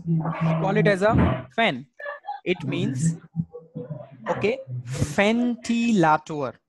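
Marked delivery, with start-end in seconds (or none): 4.8-6.5 "ventilator" as fen-tee-la-too-er, slow; drawn-out teaching take of "ventilator"; German V as F. Shown as a demonstration. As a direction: say ventilator with an F and open A. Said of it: "So V sound is F sound".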